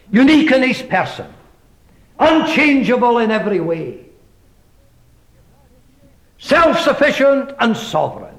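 high, rising preacher's proclamation; preaching. Acted. Preacher proclaiming God's presence to the congregation, fervent. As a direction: proclaim it to the hall with swelling fervour.

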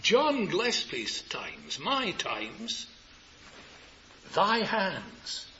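slow phrases with long pauses; said slowly. Shown as a demonstration. Preacher speaking for a listener, slowly and deliberately. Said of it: "Now say it slowly".

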